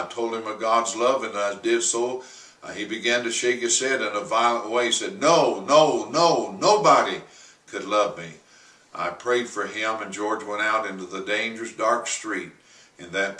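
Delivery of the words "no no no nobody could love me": anguished refusal. Retold or imitated. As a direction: refuse in a frenzy. George shaking head violently.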